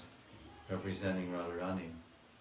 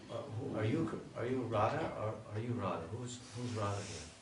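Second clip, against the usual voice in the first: confused gopis. Retold or imitated. Gopis question the two Krishnas.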